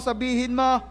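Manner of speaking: ordinary talk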